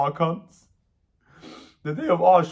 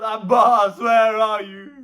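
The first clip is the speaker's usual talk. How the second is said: high, wailing roll call of names; desperate calling out; 6 semitones higher, 5 dB louder, slower than usual. Retold or imitated. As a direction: call each lost companion in grief. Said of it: "He calls out".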